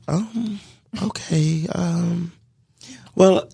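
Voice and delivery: high, halting imitation of her; hesitant reply. Woman weighs his proposal, high hesitant reply. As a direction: falter upward between long pauses.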